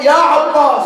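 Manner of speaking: loud high cry of the name; shouting out. Retold or imitated. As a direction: cry out to Abbas with full voice. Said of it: "صار يصيح". (صيحة عالية باسم العباس)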